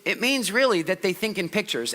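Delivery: normal talking voice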